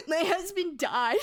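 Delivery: hammed-up wail, high and slow; laugh-cry it out like a melodramatic widow; exaggerated tearful blurting; mock sobbing. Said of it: "sobbing my fucking brains out".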